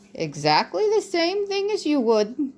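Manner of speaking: put-on elderly mouse, wry and candid; old brother mouse. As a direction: creak it out like an ancient kindly mouse. Old mouse advising Matthias.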